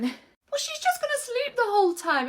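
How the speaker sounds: ordinary talk, nothing marked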